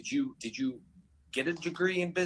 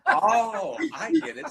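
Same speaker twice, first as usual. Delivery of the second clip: high, loud, laughing through the words; laughing realization; 12 semitones higher, 8 dB louder, slower than usual. Retold or imitated. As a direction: get the joke and crack up. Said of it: "Everyone was like".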